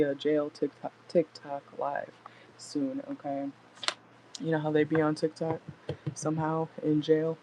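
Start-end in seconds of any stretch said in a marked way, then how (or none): none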